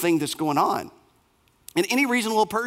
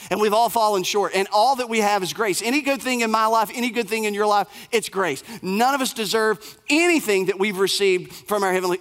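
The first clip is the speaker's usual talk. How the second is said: ordinary talk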